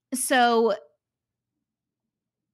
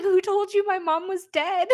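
much higher incredulous question; baffled question; 9 semitones higher than usual. Retold or imitated. Mina puzzled by Dune's claim about her mom, higher baffled question. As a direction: ask it completely baffled.

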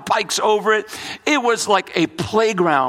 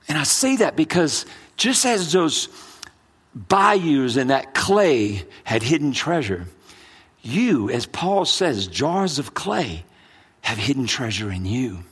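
hushed preaching; 3 semitones lower than usual. Acted. Sermon turns to quiet application.

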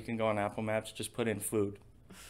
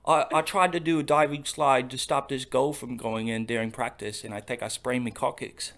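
louder, lightly done English footballer voice; playing an injured English player; mock English accent; 4 dB louder than usual. Playing an English footballer in a mock English accent. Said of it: "our terrible English accents".

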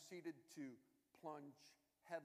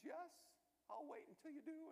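frantic; 14 semitones higher than usual. Retold or imitated. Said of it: "And she's already frantic".

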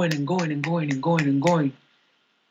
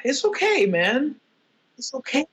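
mock self-soothing; 8 semitones higher than usual. Comforting himself after crying.

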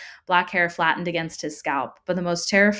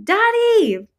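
very high, loud call for Daddy; desperate scream; 7 dB louder than usual. A narrator's reading. Tearful trapped child calling father, desperate scream. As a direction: scream for your father to come.

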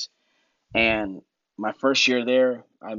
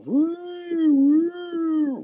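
much higher, sung-out siren wail; siren imitation; 3 dB louder than usual. Imitating the rocket attack siren going off.

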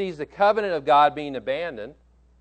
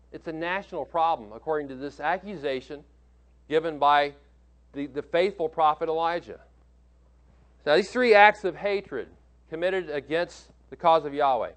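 ordinary talk, nothing marked

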